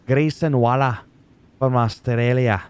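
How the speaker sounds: a terrible, hammed-up Australian accent; mock Australian accent, just for fun; bad Australian accent. Shown as a demonstration. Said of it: "That was a terrible accent".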